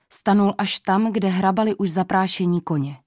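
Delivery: usual speaking voice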